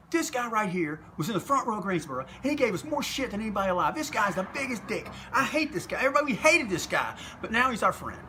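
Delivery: high-pitched, emphatic imitation of a wrestler; mock tirade. Wrestler introduces old heckler to a woman, mock tirade.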